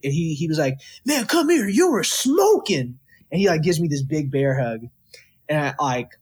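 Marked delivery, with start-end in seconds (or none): 1.1-3.0 excited praise. Retold or imitated. Bandleader praising young soloist.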